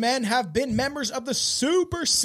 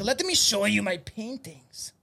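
put-on German accent. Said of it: "It's not really good German accent".

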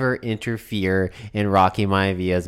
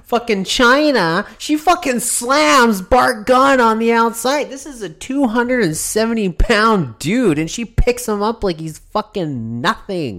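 live play-by-play; 13 semitones higher, 4 dB louder than usual. Acted. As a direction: call the slam like a wrestling announcer. Play-by-play of a match.

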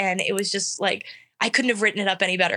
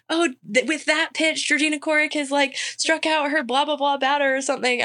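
higher, announcer-style readout; mock announcer; 6 semitones higher than usual. Stadium announcer calling her strikeout over the speakers, mock hype, higher. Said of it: "put some banner on and be like".

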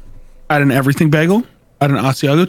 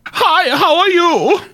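high, slow squeaky little pig voice; Shrek pig impression; 11 semitones higher, slower than usual. Asked to impersonate the Shrek pig for the co-host, high and slow. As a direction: squeak it out slowly like a cartoon pig. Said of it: "one of the three little pigs from the Shrek movie".

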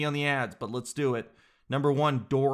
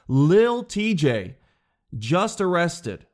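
dramatic news read; 3 semitones higher, 5 dB louder, much slower than usual. Acted.

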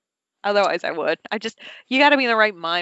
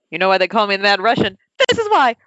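loud, rising mock boast; Mad Russian; 7 dB louder than usual. Host plays a grudge-holding Russian driver, loud hammed-up boast. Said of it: "he would say it all in Russian".

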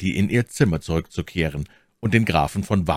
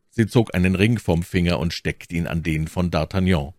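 plain reading voice

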